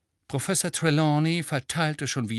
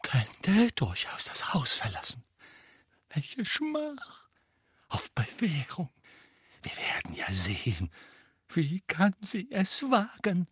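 whispered grumbling with sudden outbursts; offended teacher muttering to herself while pacing, whispered; muttered threats; 6 semitones higher than usual. Acted. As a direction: mutter threats under your breath. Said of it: "murmelte sie etwas in sich hinein".

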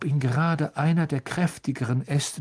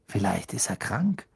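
ordinary delivery, nothing marked

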